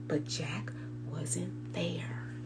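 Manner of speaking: hushed unvoiced whisper; whispered reveal. A narrator's reading. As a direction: whisper the surprise to the kids. Suspense reveal in story.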